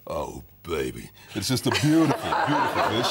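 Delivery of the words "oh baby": slow, low swoon over the taste; savouring delight. Acted. Trailer man recalling tasting red snapper, slow low swoon. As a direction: drawl it with relish, softly. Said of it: "I think".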